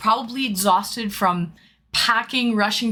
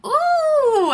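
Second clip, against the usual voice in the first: excited ooh; 5 dB louder than usual. Real, not acted. Delighted at aquarium.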